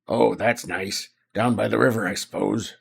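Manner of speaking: put-on gravelly landlady. Landlady making small talk about the river, gravelly voice. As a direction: rasp it like an old innkeeper. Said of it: "I shouldn't have done a gravelly voice".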